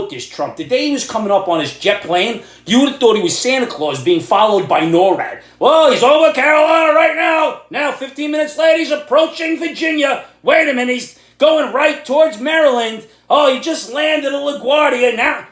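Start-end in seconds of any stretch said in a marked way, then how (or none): 5.6-15.5 higher, half-shouted breathless breaking-news voice; mock news anchor. Mocking TV news tracking Trump's jet like Santa. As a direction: ham up a frantic live tracker.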